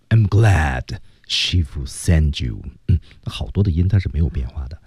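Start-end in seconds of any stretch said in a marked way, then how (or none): 0.1-2.6 I'm glad Shifu sent you, deep and slow; low slow demo. Shown as a demonstration. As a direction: drop low, keep the sounds. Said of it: "基本发音是不变的".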